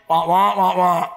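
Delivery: Charlie Brown teacher voice; muted trombone wah wah noises; muffled wah wah talk. Retold or imitated.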